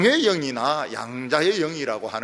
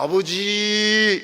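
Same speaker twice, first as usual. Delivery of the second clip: very slow, louder 아버지; drawn-out call; 4 semitones higher, 6 dB louder, much slower than usual. Shown as a demonstration. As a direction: stretch out the word Father tenderly. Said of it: "이렇게 부르면".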